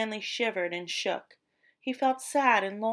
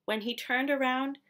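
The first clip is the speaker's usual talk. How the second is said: plain reading voice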